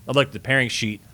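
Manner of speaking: ordinary talk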